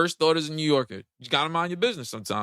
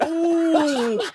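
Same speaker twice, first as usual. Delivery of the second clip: high, drawn-out ooh; mock-delighted high oohing; imitated Wheezy gif ooh; 9 semitones higher than usual. Host imitating Voldemort as Wheezy gif, high ooh. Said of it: "he'd have been like that Wheezy gif".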